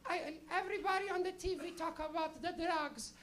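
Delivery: put-on high, slow Portuguese-accented mother; high Portuguese mom voice, drawn out; little Portuguese lady. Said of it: "she's a little Portuguese lady".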